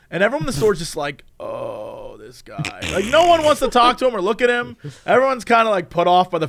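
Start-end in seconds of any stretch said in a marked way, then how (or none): 1.4-2.8 low, hushed groan of recognition; weary dread. Acted. Everyone in the store reacting, quiet weary dread. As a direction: mutter it low, weary of him.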